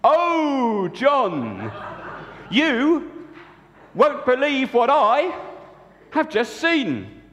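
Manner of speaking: high, slow, breathless excitement; Mary, excited. Acted. Mary tells John she has seen something amazing. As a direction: stretch it out, bursting with news. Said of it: "she said".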